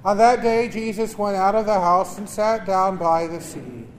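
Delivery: solemn liturgical reading; Gospel reading. Acted. Proclaims the Gospel at Mass.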